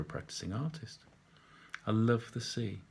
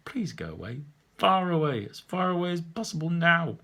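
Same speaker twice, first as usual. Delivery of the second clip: rising to a loud, higher plea; inner shout; 7 semitones higher, 6 dB louder than usual. Retold or imitated. Painter silently begging the old man to leave, building to a loud plea. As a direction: inwardly shout him away. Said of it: "inside my body shouting".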